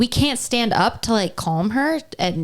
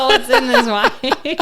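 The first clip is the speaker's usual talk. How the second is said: laughing; 5 semitones higher, 3 dB louder, slower than usual. Real, not acted. Laughing at own silliness.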